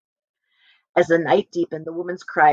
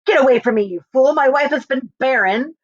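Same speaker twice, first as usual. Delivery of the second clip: angry husband; 5 semitones higher than usual. Acted. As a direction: snap at the foolish woman. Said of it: "with displeasure and dislike".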